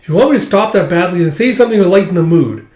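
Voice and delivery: high, loud, rushed jitters; nervous whine. Retold or imitated. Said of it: "He's like".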